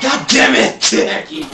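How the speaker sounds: angry shout. Real, not acted. Angry at teammate's blunder.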